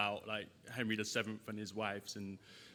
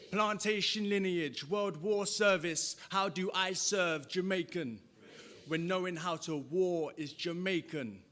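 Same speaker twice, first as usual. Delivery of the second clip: high, slow, forceful declamation; spoken-word climax; 9 semitones higher, 7 dB louder, slower than usual. Acted. Poet closing the poem for the audience, slow high declaiming. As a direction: ring out each line, stretching the words.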